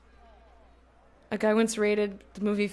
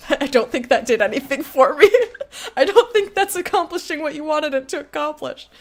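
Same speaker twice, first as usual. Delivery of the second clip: higher, louder, talking through real laughter; laughing; 6 semitones higher, 6 dB louder than usual. Real, not acted. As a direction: giggle through the words. Laughing at own failed accent attempt.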